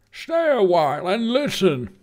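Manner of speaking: put-on super old Scottish sage, high and strained; Deckard Cain. Shown as a demonstration. Streamer showing Kane from Diablo, old Connery-like impression. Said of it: "Just think of Kane from Diablo".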